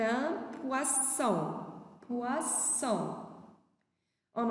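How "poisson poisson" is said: poisson slowly pulled apart into two syllables; syllable-by-syllable model. Shown as a demonstration. Teacher names the fish for pupils, syllable by syllable. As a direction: stretch out poisson for learners.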